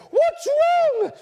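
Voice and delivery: very high, loud panicked question; hammed-up shriek of alarm; mum's frantic cry. Mum running in after huffing round the house, panicked shriek.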